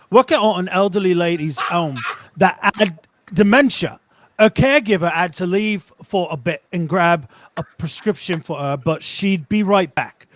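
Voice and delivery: put-on Cockney storytelling; Cockney accent. Reading a tradesman's story on request, Cockney accent. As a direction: read it like a London Cockney. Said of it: "do it in a British accent".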